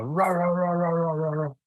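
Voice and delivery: high yappy mock bark, repeated; imitating dog barking. Telling the class about the dog at the corner, high mock barking. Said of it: "He'd come running out".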